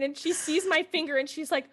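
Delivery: ordinary talk, nothing marked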